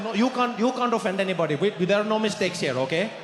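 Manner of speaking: reassuring in a fake Indian accent; hammed-up Indian accent, insistent; put-on Indian accent. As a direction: urge him on in an Indian accent. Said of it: "I also couldn't stop".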